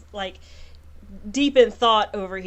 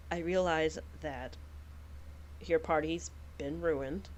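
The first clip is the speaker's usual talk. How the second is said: low gentle noble; 4 semitones lower, 13 dB quieter than usual. Acted. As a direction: speak down softly to the hostess. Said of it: "Solon was like".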